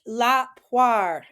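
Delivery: teacher saying it first for students to repeat; model for repeat. Shown as a demonstration. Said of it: "I'll say it first".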